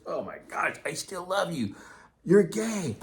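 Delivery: high-pitched, hammed-up exclamation; mock gushing. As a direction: build to a loud, silly high cry. Acted high-pitched outburst to his critics.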